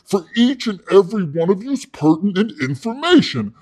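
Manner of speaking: imitating a fired-up salesman; hammed-up sales enthusiasm; hard-sell pitch. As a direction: pitch rising into the big sell. Pitch builds to a climax.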